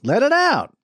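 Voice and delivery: high, loud encouragement; loud coaching cry; urging him on. A narrator's reading. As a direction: goad him to holler. Urging Claude to yell.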